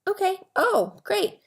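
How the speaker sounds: mock cheerful. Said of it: "And I said".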